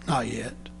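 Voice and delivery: soft, low and calm; a patient master quietly refusing; gentle. Acted. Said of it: "kindly say".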